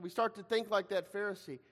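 ordinary talk, nothing marked

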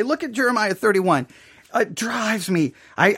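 ordinary talk

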